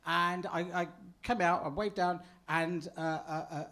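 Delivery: ordinary delivery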